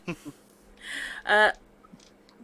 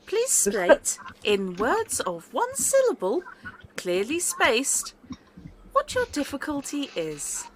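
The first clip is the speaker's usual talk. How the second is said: lightly robotic service voice, higher; automated phone voice; 5 semitones higher than usual. Acted. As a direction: read it like a polite automated line.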